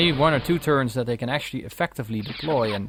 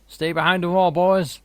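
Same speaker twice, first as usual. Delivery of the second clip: put-on commanding officer with a Southern US accent, louder; 5 semitones higher, 6 dB louder than usual. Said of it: "I have a Southern accent there".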